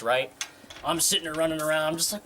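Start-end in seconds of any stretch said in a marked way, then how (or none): none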